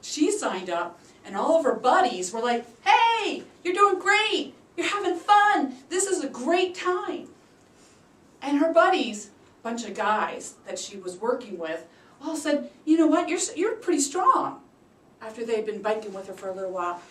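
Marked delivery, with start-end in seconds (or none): 2.8-7.3 high, loud cheering voice; cheering buddies. Retold or imitated. 12.8-14.6 high and loud in praise; impressed buddies. Retold or imitated.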